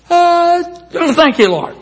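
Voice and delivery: high, awkward little thank-you; mock-sheepish thanks; sheepish mumbled grace. Sheepish prayer in a restaurant.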